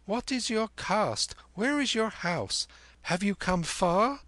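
put-on high-pitched inquisitive boy; high, eager youngster; curious boy Kim. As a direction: fire questions in a high boy's voice. Narrator plays Kim questioning.

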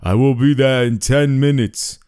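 put-on building menace, Austrian accent; creepy Viper voice. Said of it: "the same creepy voice said".

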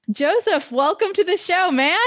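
bright, high-pitched greeting that leaps up; excited welcome. Real, not acted. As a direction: welcome the guest like you're thrilled he's here. Host greets guest enthusiastically.

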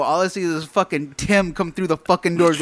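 ordinary talk, nothing marked

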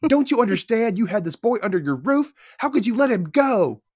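high-pitched, acted reproach; yelling accusation. Katemski blames Patty for losing the boy, high mock yelling. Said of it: "this guy yelling at my wife".

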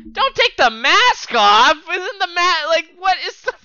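shouting. Real, not acted. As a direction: yell at the screen. Yelling at the wrestler.

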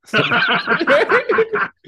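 high, loud real laugh; burst of laughter. Real, not acted. Teller laughing at the helicopters question, high and loud. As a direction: crack up at the story.

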